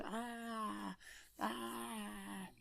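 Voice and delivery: high, soft strangled gasps; little hurt yelps; mock pain cries. Luke being zapped by lightning, soft high pained cries.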